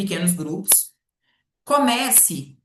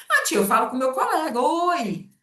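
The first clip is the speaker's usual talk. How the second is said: put-on higher kid voice explaining; child's voice; 7 semitones higher than usual. Child telling the teacher how it greets a friend. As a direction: answer like a small pupil. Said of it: "o que que é isso, oi?".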